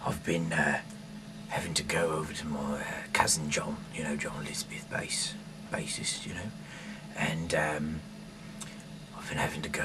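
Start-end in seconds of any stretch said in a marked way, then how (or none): none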